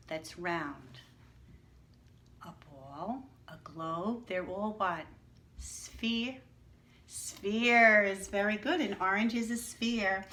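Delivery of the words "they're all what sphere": usual speaking voice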